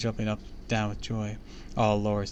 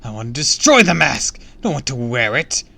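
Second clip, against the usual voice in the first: loud, raised villain voice; angry shout; 3 semitones higher, 13 dB louder than usual. A narrator's reading. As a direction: shout your protest with full force. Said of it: "shouted again".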